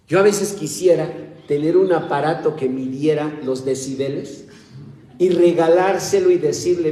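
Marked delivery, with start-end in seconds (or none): none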